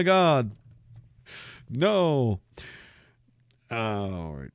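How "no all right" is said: ordinary delivery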